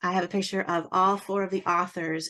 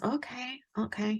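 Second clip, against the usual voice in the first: soft, higher noncommittal murmur; imitated preoccupied technician; 6 semitones higher, 6 dB quieter than usual. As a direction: lightly mimic someone absorbed in a screen. Mimicking tech studying the screen.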